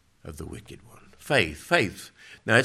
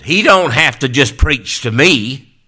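loud, raised indignant retort; mock the listener who felt preached at; offended complaint; 7 semitones higher, 16 dB louder than usual. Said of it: "Here's what they said".

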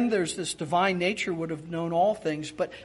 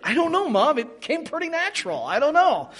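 sheepish son; 7 semitones higher than usual. Retold or imitated. Son answers scolding mom, joking.

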